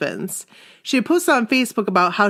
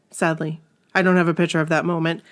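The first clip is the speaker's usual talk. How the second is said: usual speaking voice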